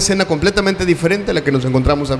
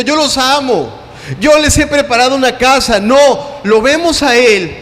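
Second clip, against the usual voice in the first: higher, impassioned preaching voice; fervent preaching; 5 semitones higher than usual. Acted. Preacher urging the congregation about Christ weeping, fervent. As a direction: preach it with rising fervour.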